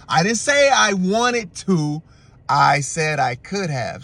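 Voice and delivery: slow, drawn-out acted protest; mock indignant insistence. Boyfriend correcting girlfriend about tacos, slow mock indignation. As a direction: imitate someone spelling out an objection.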